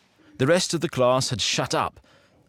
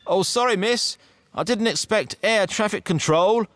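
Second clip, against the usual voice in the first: much higher, cheeky loud quip; said loudly; 9 semitones higher than usual. A narrator's reading. Boy joking loudly at the strict substitute teacher, higher and loud. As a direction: call it out for the whole class to hear. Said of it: "I said loudly".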